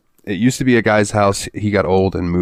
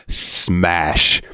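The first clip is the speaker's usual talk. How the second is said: ordinary talk, nothing marked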